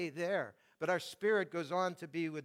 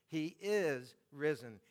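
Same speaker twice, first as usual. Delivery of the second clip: slow line, "is" stretched and emphasized; stress on IS; slower than usual. Shown as a demonstration. Preacher repeating the verse to show emphasis, stress on "is". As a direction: hold the word "is" before going on. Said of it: "notice the emphasis".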